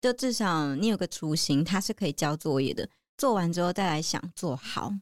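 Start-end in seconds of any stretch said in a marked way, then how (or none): none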